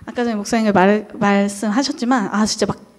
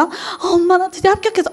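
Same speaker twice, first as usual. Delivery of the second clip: higher, proud announcement; excited news; 7 semitones higher than usual. Retold or imitated. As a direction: tell mom the good news eagerly. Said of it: "엄마한테 전화를 했죠". (신나서 자랑하는 말투)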